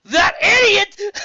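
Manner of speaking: shrieking high furious yell; high-pitched enraged shriek; rage scream. Acted. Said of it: "screamed in utter rage".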